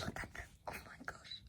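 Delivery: breathless hushed oh my gosh; awed whisper. Real, not acted. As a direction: whisper it in awe. Whispered awe.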